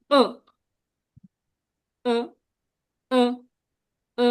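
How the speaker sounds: ও said short with a falling tone; teacher demonstrating falling-tone ও. Shown as a demonstration. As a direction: drop the pitch on each separate ও. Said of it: "falling টোনটা হচ্ছে ইর উচ্চারণটা এরকম হবে".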